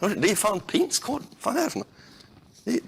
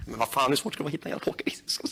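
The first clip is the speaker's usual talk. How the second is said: frustrated whisper; 6 semitones lower, 6 dB quieter, much faster than usual. Real, not acted.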